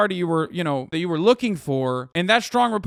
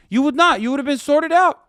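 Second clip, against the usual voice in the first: yelling in real rage, much higher and louder; furious shout; 9 semitones higher, 6 dB louder than usual. Real, not acted. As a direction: blast them with fury. Furious rant resumes.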